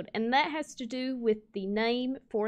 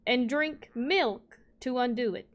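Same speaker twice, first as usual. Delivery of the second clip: ordinary talk, nothing marked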